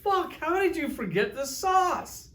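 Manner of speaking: mock-outraged what the fuck; shrieking disbelief. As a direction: squeal it like you've been betrayed. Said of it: "you're like".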